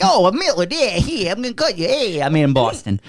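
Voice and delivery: bad Boston accent. Mocking the killer in a bad Boston accent. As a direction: fake Boston voice that drifts toward Italian. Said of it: "That was Boston?".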